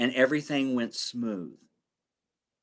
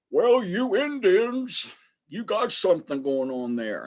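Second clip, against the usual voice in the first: typical construction guy; 5 semitones higher than usual. Retold or imitated. Construction director praising the Indian blessing, lightly voiced working guy. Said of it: "so typical construction guy".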